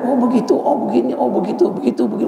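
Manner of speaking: high mock nagging, words tumbling; ranting wife. Preacher voices a wife scolding her husband, high mock nagging. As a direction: imitate a wife going on and on.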